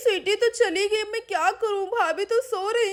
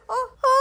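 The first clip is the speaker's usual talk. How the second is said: high drawn-out "aah"; falling scream; 7 semitones higher, 4 dB louder than usual. Acted. Pinky falling from the tree, frightened scream.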